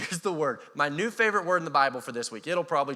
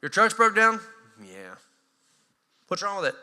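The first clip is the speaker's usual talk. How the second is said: mechanic asking; 2 semitones higher, 5 dB louder than usual. Retold or imitated. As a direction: ask like a puzzled mechanic.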